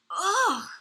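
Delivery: high, loud disgusted ugh; disgusted splutter. A narrator's reading. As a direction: spit out a revolted ugh. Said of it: "she spluttered".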